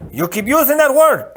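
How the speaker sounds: high, loud snap; snapped retort. Acted. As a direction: cut him off sharply. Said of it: "the Spaniard snapped".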